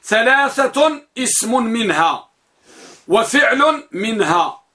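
slow, deliberate spelling-out of the estimate; spelling out the implied words slowly; slow dictation. Shown as a demonstration. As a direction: lay each word down slowly for students to copy. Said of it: "يكون تقديره هكذا".

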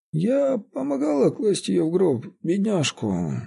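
high-pitched, slow, tearful whine; whining old woman. A narrator's reading. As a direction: whine pityingly like an old caretaker. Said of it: "сказала она плаксивым тоном".

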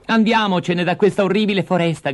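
high-pitched urgent call; crying out. Acted. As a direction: call out high, eager to get away. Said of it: "gridò". (grido acuto per scappare)

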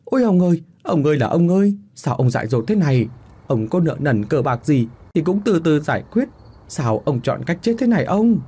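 high-pitched, alarmed wailing shout; crying out. A narrator's reading. Servants finding their master hanging, crying out. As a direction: wail out in alarm, pitched well up. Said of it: "hai thằng mới la lên".

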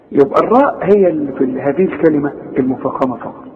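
ordinary talk

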